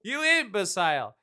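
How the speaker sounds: loud, high, incredulous rant; mock outrage. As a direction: shout it in comic exasperation. Mock outrage at film's love answer.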